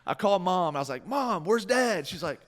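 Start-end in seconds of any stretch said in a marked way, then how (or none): 1.1-2.1 urgent question. Retold or imitated. Urgent call to mom.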